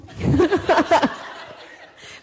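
hearty burst of laughter; loud laugh. Real, not acted. Laughing at her own story, hearty laugh.

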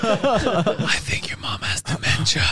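slow, voiceless stage whisper; hammed-up hushed whisper, drawn out; mock whisper. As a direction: whisper the jab very slowly. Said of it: "She started whispering".

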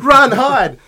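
high, loud hammed-up panic; mock panic. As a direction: shriek it like fake terror. Joking war panic.

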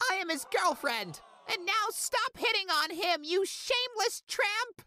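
very high, jealous screeching; shrieking girlfriend. Retold or imitated. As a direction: screech at the rival like a jealous teen.

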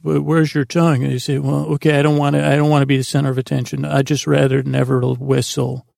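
usual speaking voice

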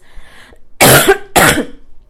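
harsh loud coughs; coughing fit. Real, not acted. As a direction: cough hard into the mic. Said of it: "Sorry about all the coughing".

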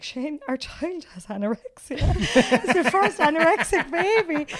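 mock-dramatic, then breaking into laughter; laughing mock alarm. Wife telling husband the baby's weight, laughing mock alarm. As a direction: play the drama and laugh through it.

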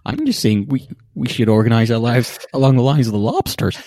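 high, loud parody voice; mock earnest arguing. Co-host parodying a debater on lobsters, mock earnest arguing. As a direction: press an absurd point in a put-on voice.

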